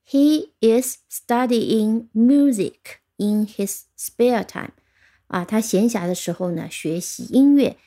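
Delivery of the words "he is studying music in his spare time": slow, evenly spaced words; model sentence reading. Shown as a demonstration. Teacher giving learners an example sentence, slow model reading. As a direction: read it out slowly for learners. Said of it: "我们听一个例句".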